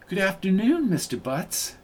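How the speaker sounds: lifted, refined greeting that falls away softly; high, classy voice. A narrator's reading. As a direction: greet him like a classy lady, starting high. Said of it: "She spoke in a high, classy voice".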